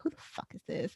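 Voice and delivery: unvoiced mock grumble of regulars; whispered old-timer. Old casino regulars muttering about the winner, unvoiced, mock annoyed. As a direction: hiss it like a pissed old gambler.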